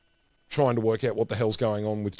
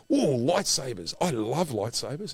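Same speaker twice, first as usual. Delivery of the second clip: higher, drawn-out fanboy glee; imitated delight; 5 semitones higher than usual. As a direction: gush slowly in mock excitement. Retold delight at seeing lightsabers.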